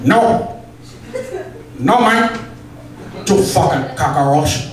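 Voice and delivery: put-on shouting in a terrible Pacino Cuban accent; bad Scarface Al Pacino. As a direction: bellow it like a hammed-up Scarface. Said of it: "I can't do a Cuban accent... Scarface Al Pacino".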